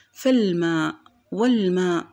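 فالماء، والماء with fa and wa joined straight to the l; no glottal stop before the l in فالماء، والماء; hamza dropped. Shown as a demonstration. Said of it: "هنا لم أنطق الهمزة".

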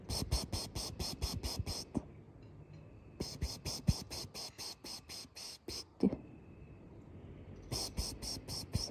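rapid, soft, voiceless "psst" calls; cat-calling hiss. Real, not acted. Woman luring kittens to look at her, cat-calling psst. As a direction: coax a shy cat with little hisses.

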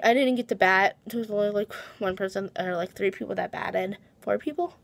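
ordinary talk

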